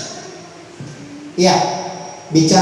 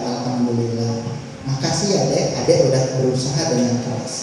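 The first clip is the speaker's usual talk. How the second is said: softer, lower grateful words; appreciative praise; 6 semitones lower, 5 dB quieter than usual. Shown as a demonstration. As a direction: thank the child tenderly for trying. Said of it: "Coba hargai". (orang tua memuji usaha anak dengan lembut)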